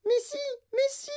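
put-on extremely high miniature boy; very high, tiny piping voice; tiny mouse-squeak voice. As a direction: squeak it out like someone an inch tall. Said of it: "fit la petite voix de Mike Tivy".

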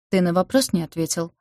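ordinary delivery

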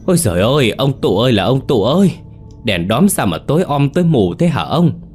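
put-on high, loud frightened wail of an old woman; wailing cry. A narrator's reading. Grandmother cries out to her husband in the dark, wailing. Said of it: "kêu lên".

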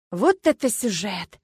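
avid, drawn-out delight in the scandal; greedy relish. A narrator's reading. Friend grabbing Lyuba's arm over a juicy story, avid. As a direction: savour it slowly, with loud emphasis. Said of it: "жадно сказала подруга".

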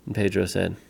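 ordinary delivery

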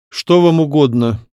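a touch of lordly disdain; haughty. A narrator's reading. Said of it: "надменно спросил Дмитрий Григорьевич". (надменно)

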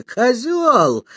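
high-pitched acted yell of abuse; angry yell. A narrator's reading. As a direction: cry out the insult, pitch jumping up. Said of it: "завопила Аня".

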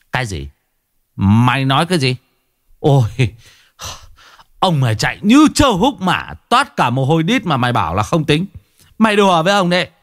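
put-on old man rants at the organizer; outraged protest. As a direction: refuse to accept the ruling.

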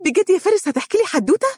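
very high, quick, delighted voice; excited. A narrator's reading. Wife thrilled her husband will tell a story, excited. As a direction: squeal it with eager delight. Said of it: "صفقت بيدها".

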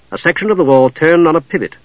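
usual speaking voice

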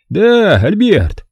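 light up as you greet him; beaming. A narrator's reading. Stanton delighted to summon his butler, beaming. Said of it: "засиял глазами Стентон".

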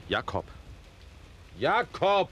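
shouted from afar, voice leaping high; calling out. Acted. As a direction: bellow his servant's name up the stairs. Master calls servant from upstairs. (laut nach dem Diener rufen)

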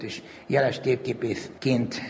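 normal talking voice

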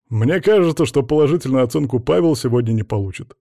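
usual speaking voice

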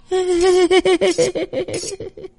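high crazed cackle; mad cackle. Acted. Bà Phú laughing after threatening them, high mad cackle. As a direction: laugh like a madwoman, high and wild.

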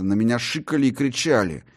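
ordinary delivery

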